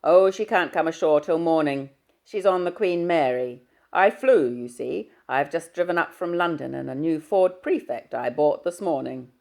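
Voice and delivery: booming fatherly hello down the line. Acted.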